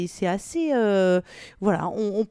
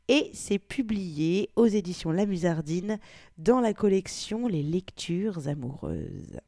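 usual speaking voice

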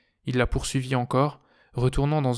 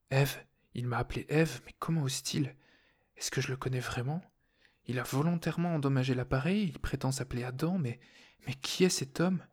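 softly voiced incredulous questioning; bewildered inner voice; 2 semitones higher, 6 dB quieter than usual. A narrator's reading. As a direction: think it to yourself in soft disbelief. Her bewildered, alarmed inner thoughts.

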